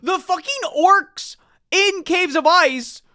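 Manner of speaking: very high-pitched, incredulous ranting; exasperated outburst. Real, not acted. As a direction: squeal it in outraged disbelief, near laughing. Real exasperated rant about the omnibus.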